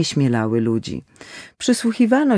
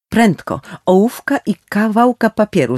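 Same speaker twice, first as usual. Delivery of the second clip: urgent, choked cry, louder and higher; stifled call; 6 semitones higher, 5 dB louder than usual. Acted. Doctor calls urgently to the staff, choked and loud. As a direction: call out urgently through a tight throat. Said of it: "zawołał zduszonym głosem lekarz".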